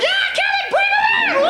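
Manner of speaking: very high, squealing classroom cheer; mock children's shrieking; rowdy kids cheering. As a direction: whoop it up as a mob of excited kids. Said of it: "And they're like".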